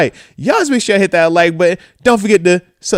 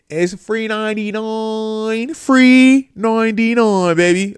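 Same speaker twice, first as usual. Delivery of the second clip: hyped-up sing-song; 6 semitones higher, slower than usual. Acted. Hyping free entry, drawn out.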